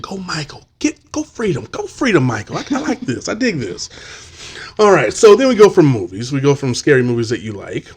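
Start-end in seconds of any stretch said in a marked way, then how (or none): 0.0-3.9 high-pitched half-hushed cheer; playful rooting for an underdog; mock cheering. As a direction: cheer him on in a high, light voice. Acting out cheering Michael on.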